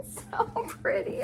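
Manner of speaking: breathless giggle through the words; giggling. Real, not acted. Fan admiring a new book, laughing softly. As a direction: laugh it out, high and airy.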